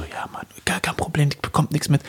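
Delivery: hushed conspiratorial whisper; whispered secret. Retold or imitated. Friend whispers secret in story. (geflüstert, wie ein Geheimnis)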